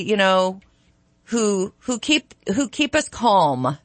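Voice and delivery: usual speaking voice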